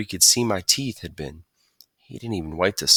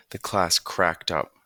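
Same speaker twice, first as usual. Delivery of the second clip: ordinary delivery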